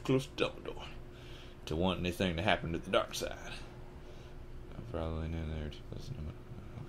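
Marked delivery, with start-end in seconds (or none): 4.8-6.8 barely audible whispered read-through. Real, not acted. Reader going back over the line, whispered. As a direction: whisper it to yourself.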